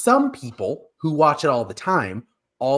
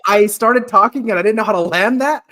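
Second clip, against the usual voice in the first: high, louder flustered laugh through the words; embarrassed laughing; 10 semitones higher, 7 dB louder than usual. Real, not acted. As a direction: laugh off the bad joke. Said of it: "That was terrible".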